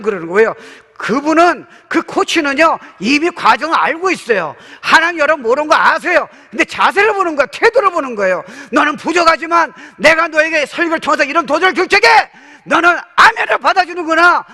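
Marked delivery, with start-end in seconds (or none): 1.0-8.4 loud, high, driving sermon delivery; full-force preaching. Acted. Pastor urging the congregation to believe, preaching at full force. 8.7-14.4 loud, high booming promise from God; God's voice, shouted. Acted. Preacher voicing God promising help, loud booming. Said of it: "이렇게 나오는 거예요".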